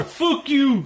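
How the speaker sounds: high squeaky imitation of Conor; mock the suit's message in a high voice.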